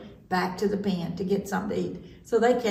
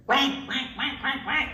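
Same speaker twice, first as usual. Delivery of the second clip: higher quack noises; duck quacking; 4 semitones higher than usual. A narrator's reading. Duck proving that ducks are quackers, quacking. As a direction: show off a row of duck quacks.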